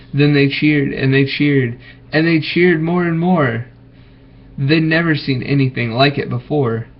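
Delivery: plain reading voice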